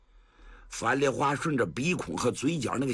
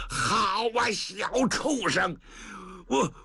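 high, stuttering fury, dragging out the insult; old man losing his temper at a cheeky grandson; enraged scolding; 6 semitones higher, slower than usual. Acted. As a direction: explode at the insolent boy. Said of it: "老程哪受得了啊".

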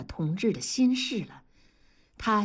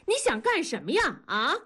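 higher-pitched demanding shout; shouted challenge; 7 semitones higher than usual. A narrator's reading. Wife shoves husband and demands an answer, shouting. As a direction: cry out, challenging them. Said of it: "喊道".

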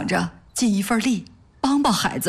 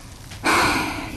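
long breathy sigh; heavy sigh. Acted. Wife about to tell husband of the child, sighing. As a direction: let out a troubled sigh. Recalling the orphan's sad day.

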